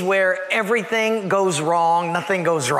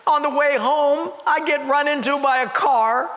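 higher, whiny voice of a man near tears; weepy sad drinker; 7 semitones higher than usual. Acted. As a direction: whimper out his tale of woe, hammed up. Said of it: "this guy starts crying".

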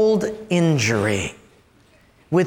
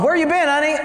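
higher-pitched mock interrogation; acted husbandly suspicion; suspicious husband; 8 semitones higher, faster than usual. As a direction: question your wife as she walks in. Acts out husband questioning wife.

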